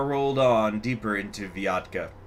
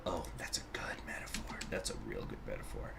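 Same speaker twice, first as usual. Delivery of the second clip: whisper; 11 semitones lower, 15 dB quieter than usual. Real, not acted. Aside admiring his own line.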